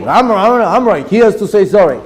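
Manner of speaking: high, insistent mock-kid complaint; childish protesting. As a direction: argue like an indignant little kid. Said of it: "That's how we sound".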